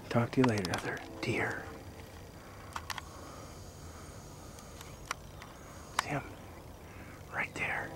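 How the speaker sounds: hushed voice so the deer stays put; wildlife watching whisper. Real, not acted. Quietly filming a deer nearby.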